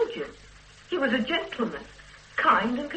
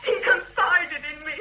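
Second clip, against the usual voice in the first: near tears, voice catching; cling to it as she starts to cry; tearful; 6 semitones higher, 4 dB louder than usual. Acted. Breaking down under interrogation.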